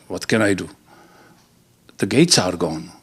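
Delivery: resigned Peter. Acted. Peter admitting to Jesus he can't help it, mildly glum. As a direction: sigh it out, defeated.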